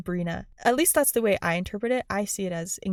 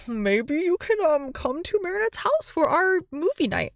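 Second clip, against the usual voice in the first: Zoe voiced higher, timid invite; lightly playing shy Zoe asking; imitating Zoe; 7 semitones higher, 3 dB louder than usual. Vlogger imitates Zoe inviting to movie night, higher and timid. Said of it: "It all starts with Zoe being like".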